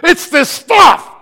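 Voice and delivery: loud, high, slow shout; frustrated shout. Retold or imitated. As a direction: bellow it out, fed up. Shouted after denial.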